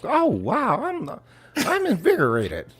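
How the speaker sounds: high, loud, overexcited fan voice. Acted. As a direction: gush like a thrilled tourist.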